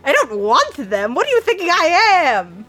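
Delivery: loud, rising to a high pitch; offended creature outburst; indignant creature. Acted. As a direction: protest loudly, ending in a high yell.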